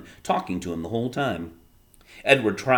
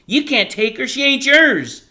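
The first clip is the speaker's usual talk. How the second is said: screaming; 11 dB louder than usual. A narrator's reading. Bryce screaming at father over sister's body, furious scream. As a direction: scream it to stop him taking her. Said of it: "Bryce screamed".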